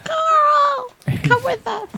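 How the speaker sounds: high sing-song coaxing call. Acted. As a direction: coax the ooze like a pet. Calling the ooze along.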